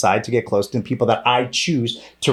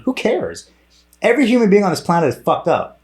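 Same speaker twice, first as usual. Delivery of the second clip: high-pitched, fed up; exasperated; 9 semitones higher than usual. Real, not acted. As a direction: throw it away, fed up. Rant continues.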